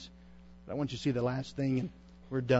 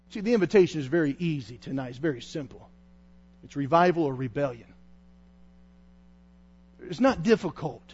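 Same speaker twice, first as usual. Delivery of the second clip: usual speaking voice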